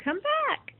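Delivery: high sing-song come back; high pet call. Real, not acted.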